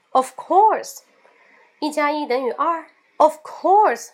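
like the answer is obvious; obviously. Shown as a demonstration. Answering a silly question, duh of course. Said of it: "有当然也有废话的意思".

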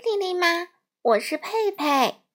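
put-on a little girl shouting happily down the phone, high; high, loud child's voice with a laugh. A narrator's reading. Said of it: "就大声地说". (小女孩对着电话大声说)

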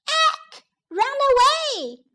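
very high panicked squeak, slow run away; tiny wasps squealing in panic. Acted. Scared wasps fleeing, very high squeals.

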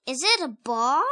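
plain reading voice

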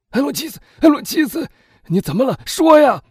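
loud, high frantic questioning, building to a shout; panicked shouting. A narrator's reading. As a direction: demand an answer in alarm. Husband finds wife dying. (惊慌失措地高声追问)

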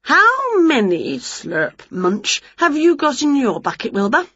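high, lively storybook witch with slurps and munches; high and eager, chewing between words; excited witch. A narrator's reading. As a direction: play a greedy, cheerful witch mid-mouthful. Winnie the witch character voice.

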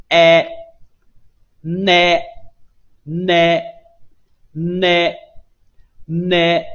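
"a", then "ne" opening onto that vowel, slow and loud; pronunciation demo of ne. Shown as a demonstration. Teacher explains tongue position to learners, slow loud demonstration of "a" and "ne". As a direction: hold each "a" and "ne" long and loud. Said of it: "回到元音a的位置".

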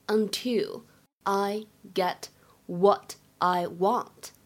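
slow, each chunk set apart by pauses; slow phrase by phrase. Shown as a demonstration. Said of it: "注意一下三个地方".

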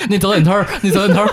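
laughing through the words. Real, not acted. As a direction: say it while cracking up. Laughing while repeating. (边笑边说)

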